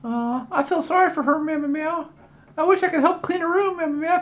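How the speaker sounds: high talking cat feeling sorry. Acted.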